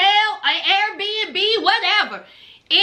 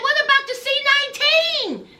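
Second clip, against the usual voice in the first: mock alarmed Memphis; 8 semitones higher than usual. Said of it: "And she said".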